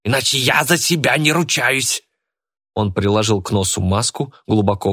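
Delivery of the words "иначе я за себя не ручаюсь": high-pitched acted threat; threatening cry. A narrator's reading. Old man threatens his rival, crying out. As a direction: pitch up the warning, without full volume. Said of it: "крикнул Тимур".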